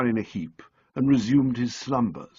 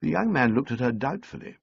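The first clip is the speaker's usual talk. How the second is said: ordinary delivery, nothing marked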